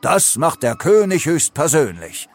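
lightly pompous royal announcement; proud. A narrator's reading. Leo appoints himself to cut cake, proudly. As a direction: puff up the chest and declare it. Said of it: "streckte stolz die Brust raus".

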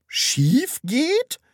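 high, loud questioning echo; curious question. A narrator's reading. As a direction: echo it as a curious question, rising sharply at the end. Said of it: "fragte Frau Rotkohl neugierig". (neugierige Nachfrage)

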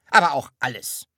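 annoyed. A narrator's reading. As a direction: insist crossly, falling at the end. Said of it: "sagte er ärgerlich". (ärgerlich beharrend)

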